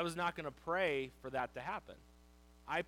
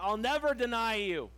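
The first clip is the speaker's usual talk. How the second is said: bold vow; 7 semitones higher, 11 dB louder than usual. Retold or imitated. Peter's bold vow retold.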